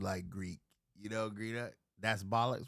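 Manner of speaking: normal talking voice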